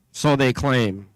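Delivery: usual speaking voice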